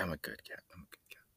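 whispered, hushed cat voice; cat's whisper. Retold or imitated. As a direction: breathe it as a sleepy cat.

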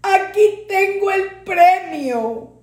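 put-on a dying boy proudly showing his prize; feverish joy. A narrator's reading. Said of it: "gimiendo decía con júbilo". (júbilo delirante de niño)

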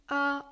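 "A" sung out with the mouth wide open; teacher demonstrates "A"; open A demo. Acted. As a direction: show the vowel "A", mouth open. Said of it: "La voix A se forme en ouvrant la bouche".

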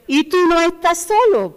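high, loud declaration; the preacher assures the congregation, loud and high; preaching shout. Real, not acted. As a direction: proclaim it to the whole room. Preaching climax.

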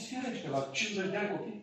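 ordinary talk

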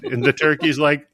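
ordinary talk, nothing marked

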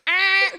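loud, high mock buzzer; imitated "wrong answer" buzzer; rejection buzzer. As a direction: blurt a game-show reject buzz. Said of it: "if I say, 'Eh,' you gotta walk away".

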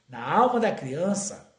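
solemn quotation. Acted. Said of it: "a Meimei diz".

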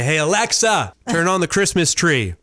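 acted-out voice command; voice assistant command. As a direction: call out an order to Alexa. Demonstrates a voice command to Alexa.

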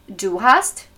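ordinary talk

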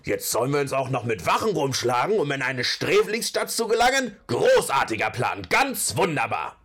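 lauter, höhnisch blaffender Zwerg; empört und sarkastisch anblaffen; aufgebracht. Acted. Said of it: "blaffte Torvald aufgebracht". (wütendes Blaffen)

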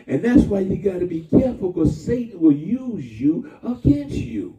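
ordinary delivery

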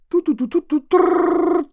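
높고 느리게 뚜르르 전화 거는 소리; 입으로 흉내 낸 국제전화 신호음. Acted. (런던에 전화 거는 장면, 높은 입소리 전화벨)

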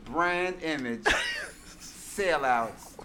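slow, half-hearted Southern attempt; Southern accent. Reading the shortest tweet for friends, half-hearted Southern accent. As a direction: try a brief, reluctant Southern accent. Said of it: "Get in your Southern accent".

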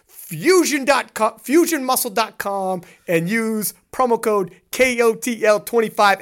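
slow, deliberate promo plug; sponsor read. Acted. Host plugging the sponsor to listeners, a slow ad read. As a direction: spell out the code for listeners.

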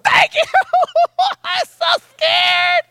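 very high, loud comic wail; hammed-up hysterical relief; scared inner squeal. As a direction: squeal it in mock terror, stretching the end. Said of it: "but inside I'm going".

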